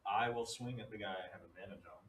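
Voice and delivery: normal talking voice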